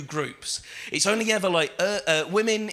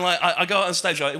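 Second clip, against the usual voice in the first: ordinary delivery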